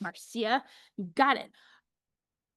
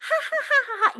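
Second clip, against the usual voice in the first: high mock laughter; mock laughing; 4 dB louder than usual. Shown as a demonstration. Teacher shows a fake, unsorry laugh. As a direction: laugh like you don't mean sorry. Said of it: "If you're like".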